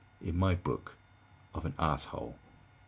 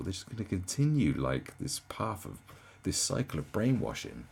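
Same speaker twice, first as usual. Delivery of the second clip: usual speaking voice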